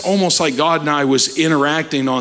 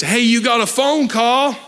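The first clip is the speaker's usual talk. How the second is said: mild imitated yell, pitched up; calling over; 7 semitones higher than usual. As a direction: call out higher, as if across the yard. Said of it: "Beth yells over and says".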